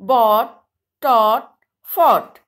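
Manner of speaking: "fought" said as fawt, each word stressed on its own; careful model words. Shown as a demonstration. Teacher showing children how the gh stays silent. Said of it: "we will pronunciate it like".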